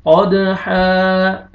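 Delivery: कद with a bouncing d, then हा drawn out slowly; stretched haa. Shown as a demonstration. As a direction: bounce कद, then hold हा long. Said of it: "हा को आपने खींचना है". (कद पर क़लक़ला, हा को खींचकर)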